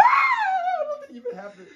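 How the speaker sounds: high shriek then laughing; startled scream. Real, not acted. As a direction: scream in sudden fright. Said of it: "Why did you scream?".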